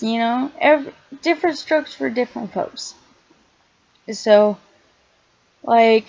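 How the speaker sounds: usual speaking voice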